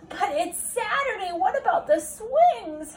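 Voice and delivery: little brother calling after his sister, high; whining little brother. Acted. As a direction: protest like a disappointed little boy. Brother's complaint.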